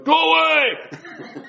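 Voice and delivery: high, drawn-out laughing "Go away!"; mock shoo-away. Telling off a door-to-door solicitor, mock shooing shout.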